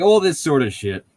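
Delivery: normal talking voice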